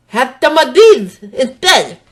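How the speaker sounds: slow words with tongue stuck out, loud. Acted. Dinky offering berries to the woman, tongue out. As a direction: talk around berries on the tongue.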